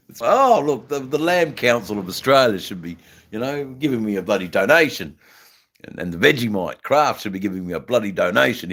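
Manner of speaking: loud, high mock-indignant complaint; playful grumble at full volume; mock indignation. Jokingly demands sponsor donations.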